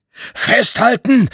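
high, drawn-out shout of alarm; bellowed warning. A narrator's reading. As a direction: yell it to the kids to hold on. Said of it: "brüllte der Bär".

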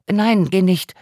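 quick, eager plea for him to stay; too quickly. A narrator's reading. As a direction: rush out the words before he can leave. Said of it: "sagte sie etwas zu schnell".

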